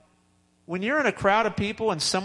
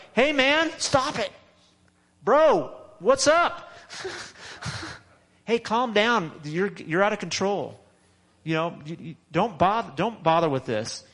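higher, mock scolding bro voice; confrontational bystander; 3 semitones higher than usual. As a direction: play the pushy guy telling him to knock it off. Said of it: "they're like".

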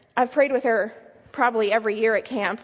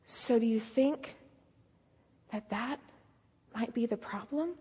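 gentle probing; 3 semitones lower, 12 dB quieter than usual. Retold or imitated. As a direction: ask carefully in a hushed voice, leaving pauses. Gently probing the girl's realization.

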